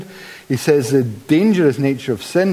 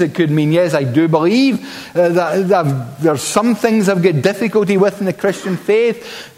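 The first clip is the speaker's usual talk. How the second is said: ordinary talk, nothing marked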